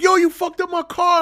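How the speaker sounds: high, loud retold complaint; mock outraged friend. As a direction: play the upset buddy yelling about his car. Friend complains about dented car.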